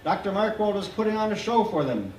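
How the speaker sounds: ordinary delivery, nothing marked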